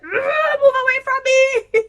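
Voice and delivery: high, loud crying shriek at him; shrieking at husband. Retold or imitated. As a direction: shriek it, pushing him away in disgust. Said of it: "I was like".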